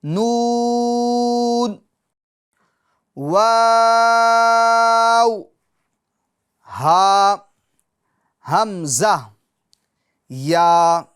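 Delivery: نون and واو held very long, then ہا, ہمزہ, یا said firmly one by one; closing run of letters read out for students; letter recitation. Shown as a demonstration. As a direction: recite the last letters slowly, holding each. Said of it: "آگے چلتے ہیں".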